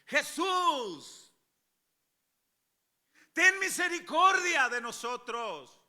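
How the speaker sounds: high, drawn-out desperate shout; crying out. Acted. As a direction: cry out for mercy at full voice. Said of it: "dando voces, era algo como esto". (gritando, dando voces)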